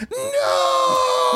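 very high, far-off wailed no; mock anguished scream. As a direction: imitate a woman wailing on her knees. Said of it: "started going".

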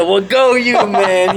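loud, high hype-up; mock congratulating cheer. Buddy on phone cheering him on, mock excited.

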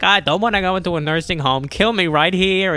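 mock elderly mother wailing her protest; old mother protesting. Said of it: "And she's like".

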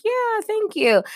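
high, bright-eyed coworker reply; imitating coworker. Coworker thanks the speaker for praise, in a high imitating voice.

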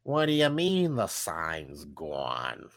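slow, low boss voice; angry boss. Acted. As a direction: drag it out like a fed-up boss. Angry boss scolding Arthur.

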